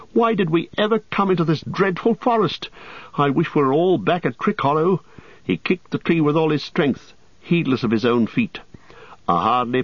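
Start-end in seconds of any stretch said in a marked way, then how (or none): 0.1-5.0 high, distressed wail of regret; wild lament. A narrator's reading. Frodo regretting the dreadful forest, crying wildly. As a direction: lament wildly in a raised pitch. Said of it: "cried Frodo wildly".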